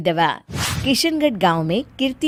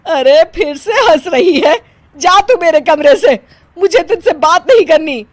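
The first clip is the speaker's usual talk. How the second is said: loud, raised-pitch indignant telling-off; angry scolding; 4 semitones higher, 5 dB louder than usual. Acted. Mother-in-law sending daughter-in-law from her room, angry. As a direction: order her out, thoroughly fed up.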